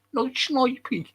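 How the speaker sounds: high-pitched mock Brummie; amused Brummie put-on voice; Brummie accent. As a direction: tease with a Birmingham accent. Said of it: "Anfield's a Brummie".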